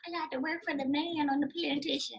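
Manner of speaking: mocking the hopeful auditioner; mock resigned actor. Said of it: "drinking the Kool-Aid of".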